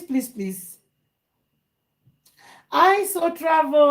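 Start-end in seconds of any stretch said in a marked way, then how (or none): 2.7-3.9 high, slow, drawn-out call; excited greeting. Real, not acted. Host greeting a viewer, excited calling out. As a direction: call out in delight to someone just spotted.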